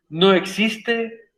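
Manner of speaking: very slow, each word weighed; slow emphatic. Real, not acted. Teacher to class on the question mark, slow and emphatic. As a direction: lean on it so the rule sticks.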